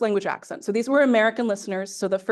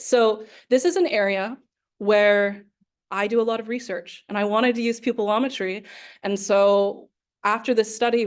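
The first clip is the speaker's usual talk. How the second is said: normal talking voice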